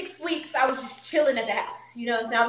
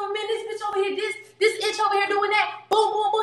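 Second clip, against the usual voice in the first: higher voice mocking his complaints; lightly voiced man telling on her; imitating boyfriend; 6 semitones higher than usual. The boyfriend blaming her to the family, mock high voice. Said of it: "He said".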